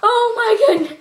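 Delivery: real overwhelmed giggling; laughing and crying. Real, not acted. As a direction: let the words give way to laughter. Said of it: "I'm, like, crying and laughing at the same time".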